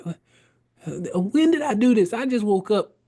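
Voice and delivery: baffled, just woken up; bewildered. Retold or imitated. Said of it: "I'm like".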